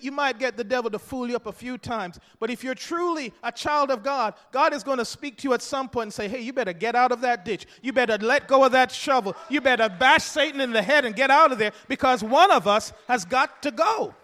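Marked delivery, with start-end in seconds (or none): 6.3-14.1 loud, rising preacher's cry; shouted sermon climax; preaching shout. Acted. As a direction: call it out with fire. God's voice calling out.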